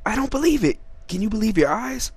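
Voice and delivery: can't believe what he's seeing; shocked disbelief. Real, not acted. Surprised by the trade.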